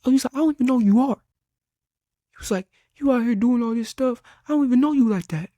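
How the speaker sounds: high, loud, fast tirade; furious coach rant. Retold or imitated. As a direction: rip into him like a raging coach. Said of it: "He spasmed, right, going crazy".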